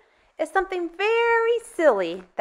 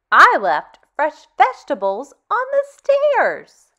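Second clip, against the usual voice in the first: put-on reading to children; 4 dB louder than usual. Teacher reading a picture book to kids, lively and expressive. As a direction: tell it like a bedtime story to little ones.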